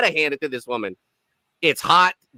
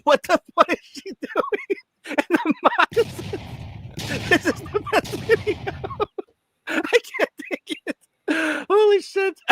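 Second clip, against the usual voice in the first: laughing. Real, not acted. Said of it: "laughing at the clothes".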